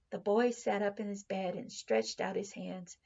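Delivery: ordinary delivery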